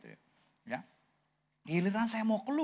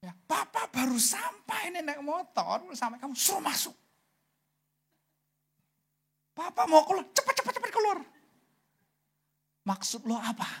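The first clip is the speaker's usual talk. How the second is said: retold angry scolding; 8 semitones higher than usual. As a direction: scold your child as an affronted papa. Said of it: "Saya marahin dia langsung".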